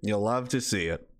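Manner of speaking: ordinary delivery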